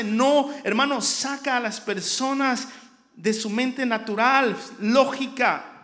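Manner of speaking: loud, driving sermon delivery; full-force preaching. Real, not acted. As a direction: preach it out hard, phrase on phrase.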